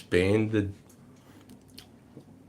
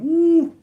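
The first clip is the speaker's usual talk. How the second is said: high whooping woo; a loud, high woo of delight; excited cheer; 8 dB louder than usual. Real, not acted. Cheering his sister on screen.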